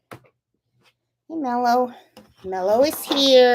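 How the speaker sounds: usual speaking voice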